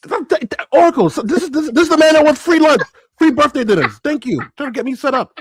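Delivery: high, loud, indignant sputter; mock outrage. To the co-host, accusing him of wanting freebies in mock outrage.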